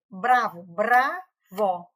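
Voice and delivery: "bravo" said loudly, split into clear beats "bra-vo"; "bravo" spoken loud and beat by beat; syllables clapped out. Shown as a demonstration. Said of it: "quando eu digo".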